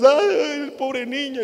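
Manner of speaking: high, loud fake lament; mock wailing mourners. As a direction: wail it like a hammed-up mourner. (plañidera exagerada y chillona, mucho más aguda)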